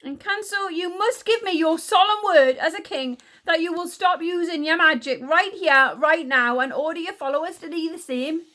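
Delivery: high, loud, exasperated demand; snapping. A narrator's reading. Queen ordering Encanso to stop magic, snapping. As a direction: order it angrily, out of patience. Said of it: "she snapped".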